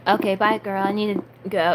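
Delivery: usual speaking voice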